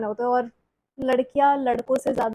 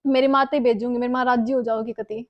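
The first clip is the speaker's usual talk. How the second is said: ordinary delivery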